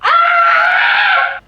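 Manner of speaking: long, very high-pitched shriek; exasperated scream. Real, not acted. Woman vents her nerves after telling the robbery, screaming. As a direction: let out a sustained scream of frustration. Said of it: "je suis très énervée".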